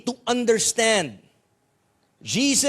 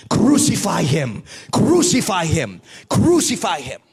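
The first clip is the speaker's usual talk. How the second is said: louder mock mob chant; crowd shouting crucify; 3 semitones lower, 5 dB louder than usual. Preacher voicing the crowd turning on Jesus, loud mock chant. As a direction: hammer each crucify him like an angry mob. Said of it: "now they were shouting".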